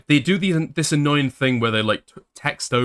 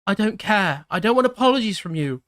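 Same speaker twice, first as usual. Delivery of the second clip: higher, accusing voice of the ex; angry ex-girlfriend; 9 semitones higher than usual. Acted. Streamer voicing the ex-girlfriend's angry messages, higher. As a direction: throw her accusations at him, higher pitched.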